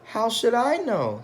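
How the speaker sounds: high, complaining wife voice; high-pitched fed-up wife; wife's voice. Acted. Wife voiced in read-aloud story.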